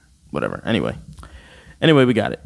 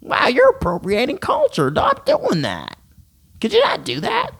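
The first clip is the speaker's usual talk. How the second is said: high, whiny voice of a preachy student; mock college student; 9 semitones higher than usual. Vlogger imitating a preachy college student, high and whiny. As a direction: play a shrill self-righteous scold. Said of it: "some stupid college student that was like".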